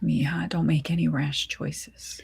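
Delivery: a mother hushing her daughter's rash thoughts; soft whisper. Acted. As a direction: warn her gently, in a hush. Mother gently advising tearful daughter.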